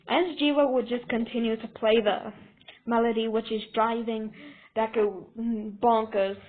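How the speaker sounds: ordinary delivery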